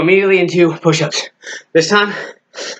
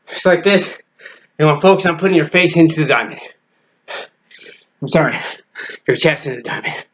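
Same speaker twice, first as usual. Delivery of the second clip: ordinary talk, nothing marked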